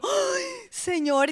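high, slow, drawn-out exasperation; a long high despairing "Ay, Señor"; exasperated sigh. Retold or imitated. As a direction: plead to heaven in dismay. Exasperated prayer reaction.

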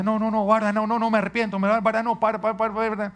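high, loud frantic begging; pleading child. Retold or imitated. Son begging his father not to spank him, high and frantic. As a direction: beg like a small boy dodging punishment. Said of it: "Él empieza".

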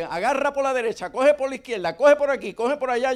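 high, loud back-seat orders; nagging wife. Retold or imitated. Wife in the car firing directions at her husband, loud and high. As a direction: bark directions like an impatient wife. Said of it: "ella diciéndome".

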